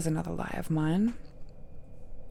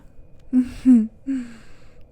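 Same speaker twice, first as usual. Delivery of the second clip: bright, louder, higher knowing laugh; 5 semitones higher, 9 dB louder than usual. Acted. Laugh after "I know better.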